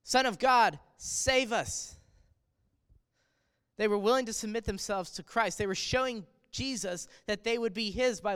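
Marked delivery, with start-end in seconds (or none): 0.0-2.0 crying out. Retold or imitated. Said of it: "crying out to him, saying".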